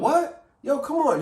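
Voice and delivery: high, loud fake surprise; mock shock. Imitating the diner stunned by the bill, high and loud. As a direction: act stunned at being stuck with the bill. Reaction when bill comes.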